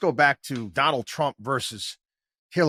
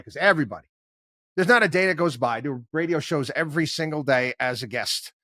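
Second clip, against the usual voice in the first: ordinary talk, nothing marked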